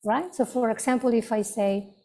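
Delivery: ordinary talk, nothing marked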